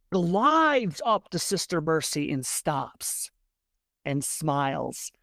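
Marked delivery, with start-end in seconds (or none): none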